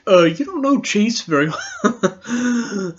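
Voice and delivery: slow, put-on smug voice; lightly hammed-up condescending guy; mock smug reply. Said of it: "Nuance Bro's just like".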